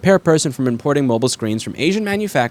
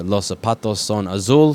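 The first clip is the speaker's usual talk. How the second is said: clumsy Spanish; 3 semitones lower than usual. Shown as a demonstration. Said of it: "took me about seven years to be able to say".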